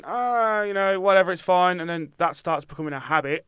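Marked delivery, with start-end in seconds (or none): 0.0-1.8 careless shrug. Retold or imitated. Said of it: "as soon as you start going".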